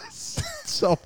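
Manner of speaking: high laughing stop. Real, not acted. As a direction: beg them to stop while cracking up. Laughing protest.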